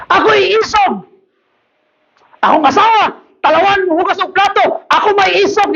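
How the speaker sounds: high, chanting comic boasts; mock boastful husband. Husband boasting of bravery while obeying his wife, mock bravado. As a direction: act out a henpecked braggart, pitched high. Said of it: "I hear pastors saying".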